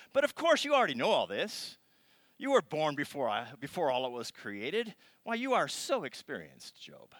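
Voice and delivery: loud sarcastic jab; mock Job with sarcasm; snarky God. God getting snarky with Job. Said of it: "he kinda gets a little snarky".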